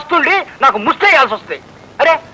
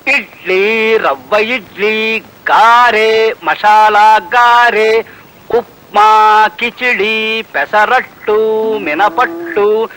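drawn-out menu recital; 3 semitones lower, much slower than usual. Retold or imitated. Server rattling off the menu.

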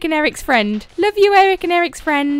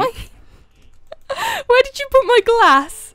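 laughing question; 6 semitones higher, slower than usual. Real, not acted.

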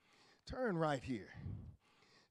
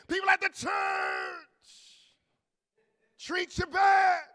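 repeated louder shout; 10 semitones higher, 12 dB louder than usual. Real, not acted. Preacher repeating it to the church, louder. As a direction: say it again with more force. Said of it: "can I say it again?".

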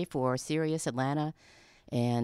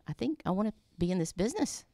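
higher, quick, awestruck thought; starstruck inner voice; 7 semitones higher, faster than usual. Retold or imitated. Impressionable new hire dazzled by scotch, starstruck. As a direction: rush it out, dazzled kid out of college.

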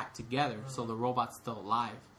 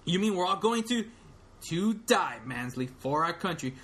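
general's disbelief; 4 semitones higher than usual. Retold or imitated. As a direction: let the horror sink in. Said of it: "read it in character of all the".

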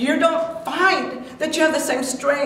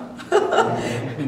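breathy joyful laugh; 4 semitones higher than usual. Real, not acted. Joyful laugh mid-sermon.

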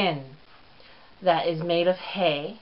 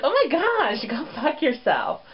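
inner snark; 8 semitones higher than usual. Pretend. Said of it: "inside you're really like".

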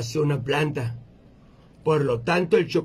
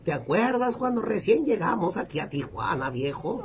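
put-on higher-pitched old granny reminiscing; abuelita; 6 semitones higher than usual. Grandma reminding her husband of their early days in Tijuana, high old-lady voice. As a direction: play a little old lady recalling old times. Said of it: "le dice".

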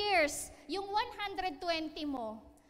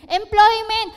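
loud announcement; 6 semitones higher, 16 dB louder, slower than usual. Shown as a demonstration. Announcing the topic word.

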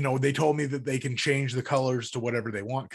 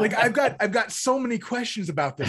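excited; 7 semitones higher, 4 dB louder than usual. Real, not acted. Excited plea, laughing.

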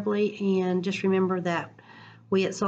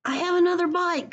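excited call; 12 semitones higher than usual. Acted. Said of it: "called Papa".

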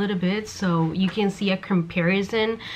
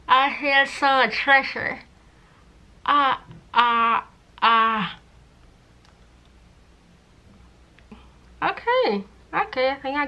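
lips held open by retractor; 4 semitones higher than usual. Real, not acted. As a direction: mouth stretched wide open. Seen 0: Cheek retractor holds lips wide open throughout; hands pull it.

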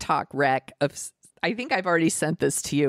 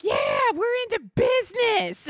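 cartoon cat voice. Showing how the comedian's cats talk, high silly cat voice. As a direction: squeal it like an excitable dubbed cat. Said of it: "they all talk like this".

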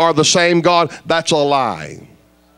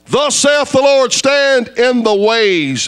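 preaching scripture; 6 semitones higher, slower than usual. Acted. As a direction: thunder out the verse slowly.

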